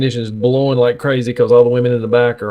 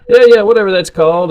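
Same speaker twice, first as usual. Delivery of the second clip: grandpa brushing it off, high and louder; voicing grandpa; 9 semitones higher, 6 dB louder, faster than usual. Grandson imitating grandpa shrugging off the name, higher and louder. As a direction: wave it away like the old man did. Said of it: "He would just make up words".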